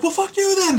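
very high, indignant brush-off; squeaky hammed-up dismissal; mock huffy retort. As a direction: storm off in fake offense. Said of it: "I love when he's just like".

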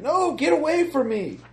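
put-on frantic child cry. Kid struggling, GM voices child.

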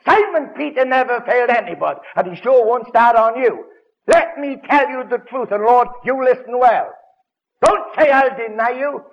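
high, loud swaggering boast; boastful Simon Peter. Retold or imitated. Simon Peter boasting to the Lord, loud and high. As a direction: crow it like a cocky fisherman bragging to the Lord. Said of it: "who said".

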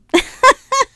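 high burst of laughter; real laugh at herself. Real, not acted. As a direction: laugh out at yourself. Laughing at own gluttony.

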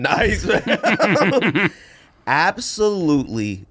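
breaking into a laugh mid-rant; amused, laughing emphasis. Real, not acted. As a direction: laugh as the advice comes out. Laughing through Jaguar rant.

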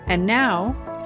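usual speaking voice

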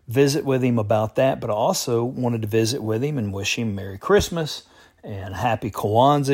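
ordinary delivery

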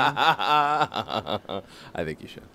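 laughing through the words. Real, not acted. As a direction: chuckle while you agree. Laughing at the voiceover idea.

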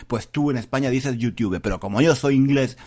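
ordinary talk, nothing marked

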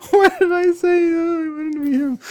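high wail, half laughing, half crying; laughing lament. Real, not acted. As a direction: bemoan your own choice through laughter. Regrets choosing the accent role.

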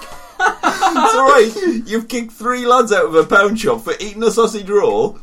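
high, loud, laughing through the words; laughing mock reply. Guard scoffing at the radio request, loud and laughing. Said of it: "It's like".

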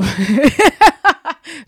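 laughing out loud at herself; loud real laugh; loud burst of laughter. Real, not acted. Laughs at own dialect attempt. (högt skratt)

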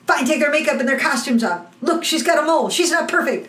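high-pitched acted spite; losing it. Leading player stripping the cast bare, losing it. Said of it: "the leading player loses his shit".

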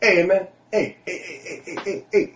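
mock tipsy stranger calling after someone; imitating the drunk guy. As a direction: rope him in with a giggly string of heys. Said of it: "and he's like".

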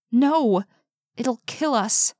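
high, frightened outburst of no; a scared girl begging not to go; fearful cry. A narrator's reading. Carmen refusing in fear.

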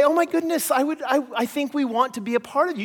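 high, breathless excitement; gushing admirer. Pretend. American neighbour gushing over the Canadian enclave. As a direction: gasp it like you've just seen paradise. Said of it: "they would say".

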